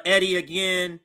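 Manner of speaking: ordinary delivery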